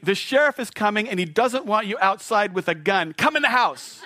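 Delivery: high, loud urgent plea; pleading wife. Retold or imitated. Wife urging armed husband to come in, high and loud. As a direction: imitate wife begging him inside. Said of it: "she's saying".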